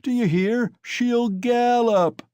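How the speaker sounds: high, slow teasing drawl; mock the boast; mocking jeer. A narrator's reading. Crowd mocks the boast.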